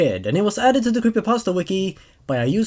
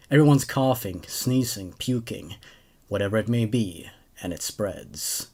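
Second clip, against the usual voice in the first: story narration; 9 semitones lower, 5 dB quieter than usual. A narrator's reading. Host reading a creepypasta to his viewers, hushed narration. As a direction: draw listeners into the creepy tale.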